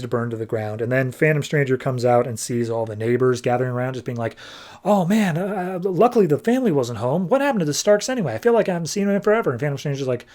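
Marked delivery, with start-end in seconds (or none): 4.8-9.5 high, quick gossipy voice; chattering neighbours. Retold or imitated. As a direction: imitate onlookers chattering fast and high.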